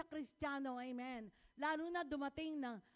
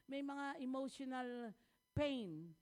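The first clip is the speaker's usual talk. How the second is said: usual speaking voice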